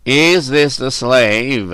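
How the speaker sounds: slow, weighty, stern questioning; deliberate, drawn-out severity. A narrator's reading. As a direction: demand it slowly like a stern judge. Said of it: "asked Cernus sternly".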